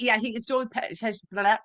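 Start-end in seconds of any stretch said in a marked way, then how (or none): none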